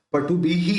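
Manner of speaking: पटुभिः with an added final hee; visarga as hee. Shown as a demonstration. Teacher confirming the rule, पटुभिः ending in hee. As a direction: sound out "patubhihee" for पटुभिः. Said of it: "उच्चारण ही हो जाएगा".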